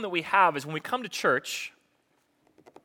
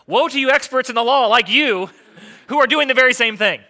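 loud, raised-pitch denunciation; emphatic rebuke; 6 semitones higher, 8 dB louder than usual. Acted. Preacher voicing Jesus turning on the law expert, emphatic rebuke. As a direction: let it ring out, high and forceful.

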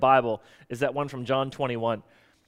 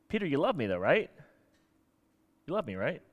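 softer, gentle asking; tender question; 7 dB quieter than usual. Retold or imitated. Jesus gently asking Peter, softer and tender. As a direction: coax it softly, like a friend.

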